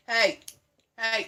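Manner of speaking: short shout to get a pet's attention; sharp reprimand; calling off the dog. Real, not acted. Calls out to misbehaving puppy.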